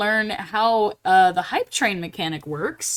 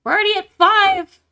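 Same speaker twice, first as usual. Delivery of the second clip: high, loud excited disbelief; amazed; 10 semitones higher, 7 dB louder than usual. Real, not acted. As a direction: exclaim in real astonishment. Amazed at subscriber count.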